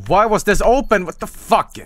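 loud, high startled swearing; startled outburst. Real, not acted. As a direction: blurt it out in alarmed disbelief. Startled by something in the game.

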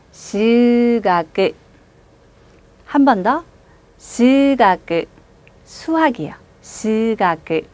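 스가크 with 스 held long, slow; 스가크, stretch 스 in one breath; long vowel. Shown as a demonstration. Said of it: "스 한 번에 읽습니다". (스가크 스를 길게)

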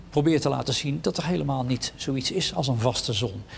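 usual speaking voice

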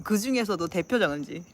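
ordinary delivery

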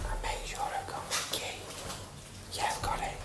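soft unvoiced whisper. Real, not acted. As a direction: whisper so nobody hears. Quiet in stairwell.

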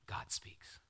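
breathy hushed announcement; reverent whisper. Acted. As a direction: whisper it like a sacred reveal. Dramatic pause before scripture.

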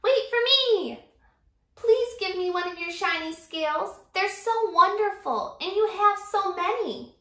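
high, pleading small voice; pleading little fish. Acted. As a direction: beg sweetly for a scale. Small fish begging for scale.

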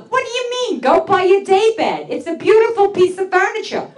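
high, baffled reply to mom; high disbelieving retort; incredulous reply. Retold or imitated. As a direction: squeak it out in disbelief. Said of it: "I said to her".

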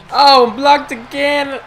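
loud, high, slow complaint; frustrated groan. Real, not acted. Gamer blocked again, slow loud frustrated groan. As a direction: drag it out in frustration.

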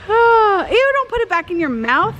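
ordinary talk

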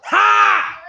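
loud barking laugh; one loud burst of a laugh; shouted laugh. Real, not acted.

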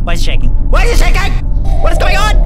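loud alarmed shouting; shouting in fright as everything shakes; panicked yelling. Real, not acted. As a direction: yell in sudden panic at the shaking room.

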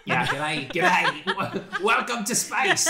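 hammed-up g'day Australian accent, laughing; mock Australian accent. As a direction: put on a jokey Aussie accent through giggles. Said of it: "What, Australian".

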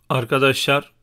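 ordinary delivery

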